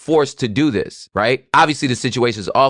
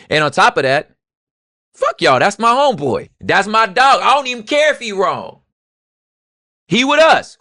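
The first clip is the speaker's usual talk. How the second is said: higher, louder street bravado; defiant outburst; 6 semitones higher, 3 dB louder than usual. Real, not acted. Defiant outburst for his homeboy.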